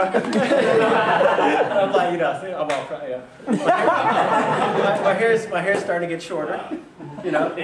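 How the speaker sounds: loud, high words broken by laughter; laughing while talking. Real, not acted. Speaker laughing while telling his mentor story, laughing. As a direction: giggle through the memory.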